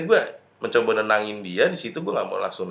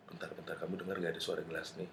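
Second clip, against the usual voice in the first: softer, fast nervous whisper; hushed and hurried; 5 semitones lower, 15 dB quieter, faster than usual. Retold or imitated. Narrator quotes himself to his girlfriend about a kitchen noise, hushed and hurried. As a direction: rush it out under your breath, uneasy.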